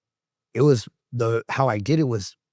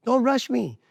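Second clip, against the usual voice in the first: imitated grandma; 10 semitones higher than usual. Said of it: "She was like".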